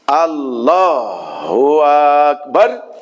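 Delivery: prayer leader's slow loud takbir call; loud drawn-out takbir. Shown as a demonstration. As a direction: call আল্লাহু আকবার out long, hitting Akbar. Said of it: "শেষ শব্দটাকে আমি একটু জোর দেব".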